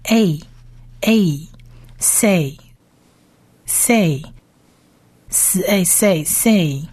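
A as a long "ay", then Say, with long pauses; sound-blending demo. Shown as a demonstration. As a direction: blend s and A slowly into Say. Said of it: "下面平行的这两点的是母音".

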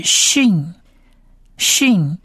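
"shin" drawn out with a clear sh; teacher naming the letter "shin" slowly; slow letter name. Shown as a demonstration. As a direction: model "shin" slowly twice. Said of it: "所以这个字是".

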